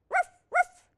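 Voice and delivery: two very high, loud puppy barks. Acted. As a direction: yap twice like an excited puppy.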